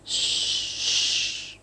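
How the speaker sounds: a long unvoiced hiss for silence; hushing hiss. A narrator's reading. Shushing his nervous friend in the dark, soft hiss. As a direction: hush him sharply in the dark.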